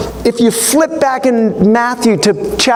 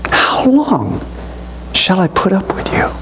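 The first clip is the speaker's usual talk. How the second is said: stern rebuke; 9 semitones lower, 4 dB quieter than usual. Retold or imitated. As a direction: let it sink heavy and weary. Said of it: "notice what Jesus says".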